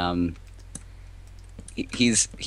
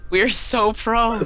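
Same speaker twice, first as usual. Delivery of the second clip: sarcastic; 12 semitones higher, 5 dB louder, much slower than usual. Real, not acted. Sarcasm right after dying.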